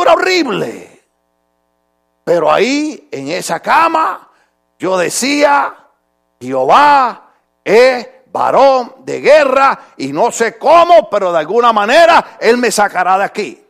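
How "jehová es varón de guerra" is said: slow, drawn-out declaration of faith; slow preacher's proclamation. Retold or imitated. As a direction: proclaim it slowly, word by word. Proclaiming faith from hospital bed.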